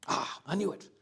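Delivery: loud, high mock-vindicated cry; mock triumph. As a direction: pounce on it, imitating someone feeling proved right. Acting out a biased searcher's discovery.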